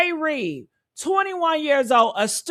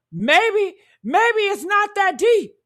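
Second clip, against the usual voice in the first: a higher, shouted mock of cautious people; mocking the calm crowd; 6 semitones higher than usual. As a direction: mimic the 'stay calm' crowd with scorn. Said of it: "we don't do calm over here".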